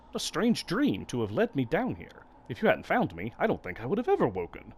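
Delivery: put-on lively, sing-song old man voice; a gentle, grateful old man; voiced old shopkeeper. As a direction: read him like a friendly village elder. Voicing Sly's dialogue.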